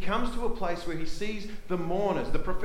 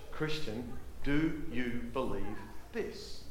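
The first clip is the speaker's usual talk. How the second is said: lower, softer, earnest voice; gentle appeal; 3 semitones lower, 4 dB quieter than usual. Acted. Repeats Jesus' words gently to church.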